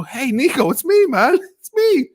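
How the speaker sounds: squeaky hammed-up buddy greeting; imagined friendly call. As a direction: play the pal calling on the phone, way up high. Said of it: "I wanted to pick up the phone and go".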